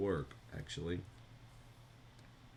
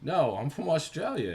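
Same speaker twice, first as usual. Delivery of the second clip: loud, higher-pitched put-on Australian accent; mock Australian accent; 9 semitones higher, 9 dB louder than usual. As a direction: claim to be Australian in a playful fake accent. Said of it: "you can't be from Australia".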